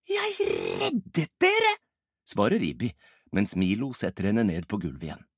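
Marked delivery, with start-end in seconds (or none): 0.1-1.8 very high, drawn-out protest; indignant retort. Acted. Ibbi answers Rolf's blame, protesting indignantly. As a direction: stretch it out high-pitched, insisting.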